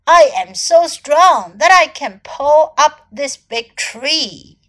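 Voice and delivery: loud storybook boast; calling out. A narrator's reading. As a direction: proudly call out a feat of strength. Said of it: "called out elephant".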